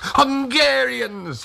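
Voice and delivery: high, long-drawn cry of welcome; joyful greeting. A narrator's reading. Old Tag opens shutters to the soldiers, joyful high cry. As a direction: hail them with delight, stretching the word.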